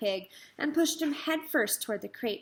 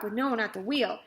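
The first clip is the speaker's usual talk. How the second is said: plain reading voice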